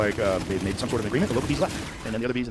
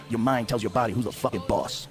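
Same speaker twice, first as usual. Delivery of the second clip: put-on slow, heavy New York accent; slower than usual. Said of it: "heavy New York accent".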